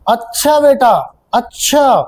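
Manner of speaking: slow, knowing अच्छा बेटा, stretched out; drawn-out teasing अच्छा बेटा at a truant; teacher catching a student bunking class; sarcastic caught-you. Shown as a demonstration. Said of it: "इसका अच्छा मतलब अलग निकल रहा है".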